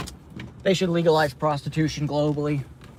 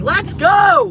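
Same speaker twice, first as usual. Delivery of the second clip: higher, louder whoop of joy; excited cheer; 12 semitones higher, 7 dB louder than usual. Real, not acted. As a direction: cheer as the engine kicks in. Engine kicks in while driving.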